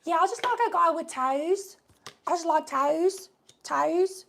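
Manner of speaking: put-on high British accent; hammed-up British accent saying toes; British girl. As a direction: imitate a Love Island girl in a British accent. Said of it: "She was like".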